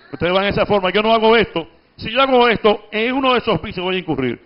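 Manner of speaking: ordinary talk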